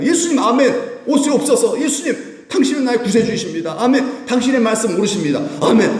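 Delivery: high, devout acceptance of Jesus; fervent believer praying. Acted. Preacher voicing a believer's eager amen to Jesus, high and devout. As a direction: pray like a wholehearted believer, voice lifted.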